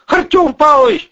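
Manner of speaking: very high, loud begging cry; desperate imploring. A narrator's reading. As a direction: cry out to the boss for mercy. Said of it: "взмолился Бандура".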